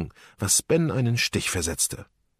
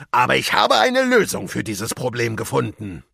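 pirate captain; 5 semitones higher, 4 dB louder than usual. A narrator's reading. Captain announces his plan.

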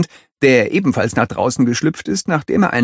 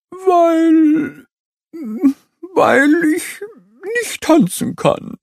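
ashamed stammer; slower than usual. A narrator's reading. Ostrich confesses.

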